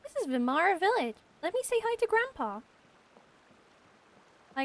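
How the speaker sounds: ordinary talk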